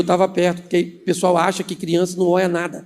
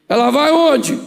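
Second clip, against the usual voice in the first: higher-pitched blurted question; gruff uncle relenting; 7 semitones higher, slower than usual. Acted. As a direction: give in with an abrupt question. Uncle gives in, asks.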